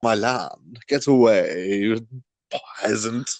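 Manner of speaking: haughty lord. Pretend. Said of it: "I'm a farmer".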